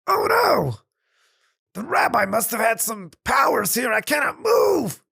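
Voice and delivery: panicked landowner. Acted. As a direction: discover you can't move and panic. Landowner finds himself frozen.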